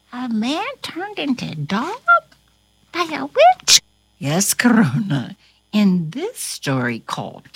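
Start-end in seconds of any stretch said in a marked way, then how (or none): 0.1-3.8 rising, wondering question; incredulous question. Pretend. Corona hearing the story's twist, asking incredulously. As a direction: ask it in disbelief, lifting at the end.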